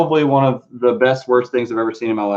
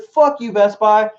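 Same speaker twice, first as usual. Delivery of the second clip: mock outrage; 10 semitones higher, 3 dB louder, slower than usual. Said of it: "It's like".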